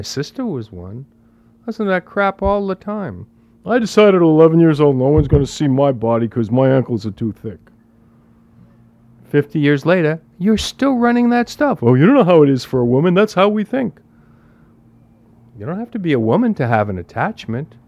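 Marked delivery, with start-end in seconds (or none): 3.6-7.6 mocking a woman. Teacher mocks a woman's lifelong excuse in a fast, loud put-on voice. 11.8-14.0 very fast, loud, higher whiny put-on voice; mocking a woman. Teacher parodies a woman's defensive excuse with a whiny mock voice.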